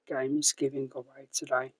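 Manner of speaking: ordinary talk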